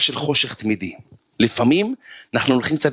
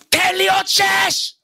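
high, drawn-out gym yell; coach's shout; slower than usual. Retold or imitated. Coach yells at a trainee from across the gym, imitated high shout. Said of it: "שומע אותו צועק עליו".

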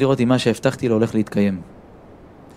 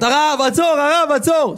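shouting after the rabbi; 13 semitones higher, slower than usual. Retold or imitated. The tailor running after the rabbi, shouting for him to stop. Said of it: "אני שומע צעקות".

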